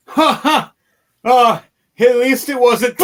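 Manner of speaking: high, mocking villain voice with a cackle; mocking laugh. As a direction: gloat like a cruel mage, high and loud. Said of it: "And he goes".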